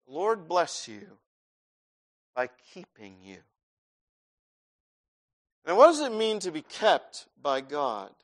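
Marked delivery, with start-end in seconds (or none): 0.1-3.4 soft, reverent answer; quiet correction. Shown as a demonstration.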